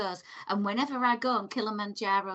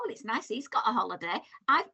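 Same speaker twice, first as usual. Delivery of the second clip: ordinary talk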